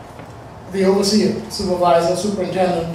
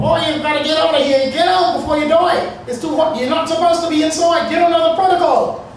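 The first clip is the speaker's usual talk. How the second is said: shouting; 9 semitones higher, 3 dB louder than usual. Retold or imitated. Workers yelling to get out.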